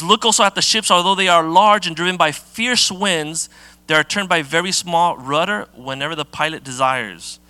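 preacher reading James to the church; scripture reading. Acted. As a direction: proclaim the Bible passage to the congregation. Reads scripture aloud.

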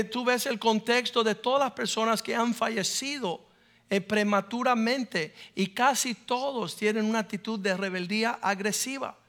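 ordinary talk

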